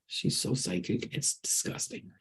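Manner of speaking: hushed whisper behind her hand; whispered aside. Real, not acted. Telling viewers about her psychic daughter, whispered. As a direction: let it slip out as a breathy secret.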